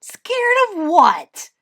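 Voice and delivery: high, loud, drawn-out disbelief; loud, slow, incredulous scoff; scornful. A narrator's reading. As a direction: challenge it like a scoffing child. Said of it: "Little Bear snorted".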